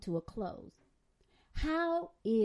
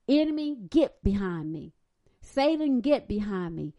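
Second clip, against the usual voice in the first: louder, commanding get behind me; preacher's loud rebuke; 5 dB louder than usual. Retold or imitated. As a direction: order the enemy away. Voicing David rebuking enemies.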